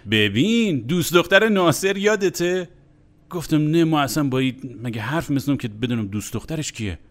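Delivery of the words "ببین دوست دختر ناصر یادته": higher and louder, imitating a snickering colleague; mock giggly teasing. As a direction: retell it through a mimicked snicker. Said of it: "همینجوری قرت قرت خنده گفت".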